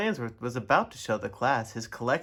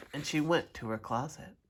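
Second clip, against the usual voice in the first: ordinary delivery, nothing marked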